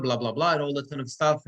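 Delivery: ordinary talk, nothing marked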